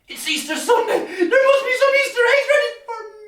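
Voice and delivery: high, fast squeal of delight; breathless giddy little boy; excited child. Acted. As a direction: burst out like a kid on Easter morning. Boy wakes on Easter morning.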